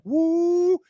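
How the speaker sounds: long, high, loud "woo"; excited whoop. Retold or imitated. Player retelling his team gaining momentum, excited drawn-out whoop. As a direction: let out a sustained high-pitched whoop.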